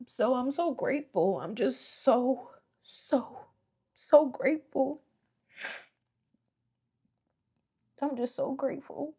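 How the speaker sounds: high, cracking, tearful thanks; overwhelmed, near tears; voice breaking. Real, not acted. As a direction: let the voice crack with gratitude.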